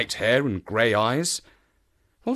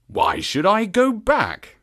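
slow, higher-pitched baffled question; puzzled surprise; 9 semitones higher, 3 dB louder, slower than usual. A narrator's reading. Stranger answers a sudden warning, puzzled. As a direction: ask it slowly, taken aback. Narrator voices his stupid surprise.

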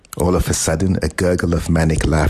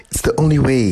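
pleading, much higher and louder; desperate; 9 semitones higher, 4 dB louder, faster than usual. A narrator's reading. Grandson pleads desperately with his grandparents, higher and louder. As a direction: push it out in desperation. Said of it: "he said desperately".

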